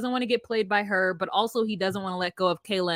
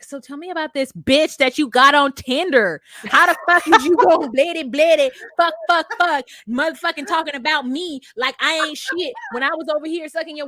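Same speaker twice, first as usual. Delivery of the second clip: louder, higher cussing rant; mock furious Kayla; 4 semitones higher, 5 dB louder than usual. Kayla ranting at Kev about his Tinder girl, loud and cussing. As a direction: tear into him like a scorned lover.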